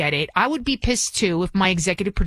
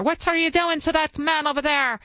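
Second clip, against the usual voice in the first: higher-pitched, put-on Irish lilt; attempted Irish accent; 7 semitones higher than usual. Host mimicking Belfast woman confronting a man, attempted Irish accent. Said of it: "I'm trying to do my Irish".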